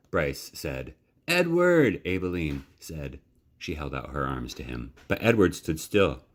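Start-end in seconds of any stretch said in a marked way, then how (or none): 1.3-2.0 high, joyful call; glad call. Acted. Abilene welcomes Edward home, high and joyful. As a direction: greet him with delight.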